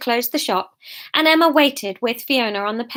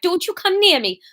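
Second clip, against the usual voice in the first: put-on higher, fierce warning in Welsh accent; 7 semitones higher than usual. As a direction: warn him off with a Welsh lilt. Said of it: "can't get back into Welsh".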